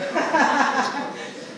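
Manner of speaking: bright burst of real laughter; short amused laugh. Real, not acted. Laughs after remark.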